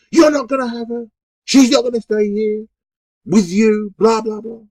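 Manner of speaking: high, loud furious yell; retold screaming. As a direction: scream it at him, losing control. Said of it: "She was just going mad".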